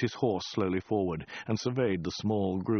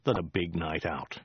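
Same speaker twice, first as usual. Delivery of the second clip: usual speaking voice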